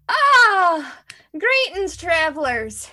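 a drawling Southern trader's welcome; southern accent. Acted. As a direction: greet them like a folksy Southern peddler. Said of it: "the southern accent really surprised me".

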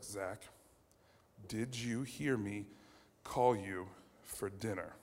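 low, quiet, slow mom interrogation; spaced out slowly, soft and stern; deadly serious. Retold or imitated. Mom confronts her lying son, deadly serious. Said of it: "It was, like, deadly serious".

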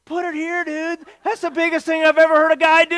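high, building to a loud awestruck dude cheer; hipster voice. Retold or imitated. Guide congratulating the brave tourist, in an excited hipster voice. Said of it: "he says in his hipster voice".

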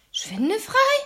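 high, loud panicked screech; very high-pitched, alarmed boy's shriek. A narrator's reading. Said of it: "kreischte Oskar wieder los".

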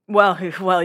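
a light mocking laugh through the words; laughing off her complaint; retold laugh. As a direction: chuckle them out, amused. Justin laughing at Audrina.

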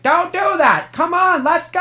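high-pitched mock yelling; hammed-up, high cartoon scream; bad Woody voiceover. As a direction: urge them on like a pestering cartoon cowboy. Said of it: "screaming at me in a really bad Woody voiceover".

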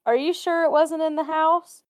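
suspicious question. Retold or imitated. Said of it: "And I was like".

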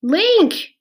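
loud, high-pitched calls of a name; two high hollers, falling at the end; calling out. A narrator's reading. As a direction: call anxiously for someone far off. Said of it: "she called".

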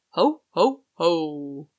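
booming playful Santa call. Acted. Man calls out as Santa.

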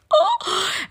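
a sharp, high delighted gasp; excited gasp. Real, not acted. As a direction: gasp in sudden wonder. Excited gasp while reading scripture.

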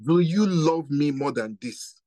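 slow, deliberate question; slow each word down so it sinks in. Shown as a demonstration. Preacher slowing down so the congregation hears it. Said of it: "so I'm going to say it slower".

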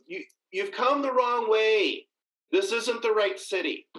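steer the blind soldiers off with mock helpfulness; confident prophet. A narrator's reading. Elisha misleads the blinded army.

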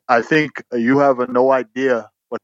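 a light put-on Ugandan accent, deadpan; a hint of Ugandan accent, calm and dry; imitated Ugandan accent. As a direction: lightly mimic Wilson's Ugandan English. Quoting Wilson's retort.

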